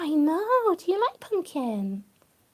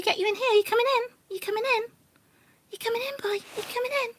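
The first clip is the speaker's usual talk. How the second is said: high coaxing; 7 semitones higher, faster than usual. Real, not acted. Coaxing rabbit into box.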